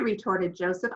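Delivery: ordinary delivery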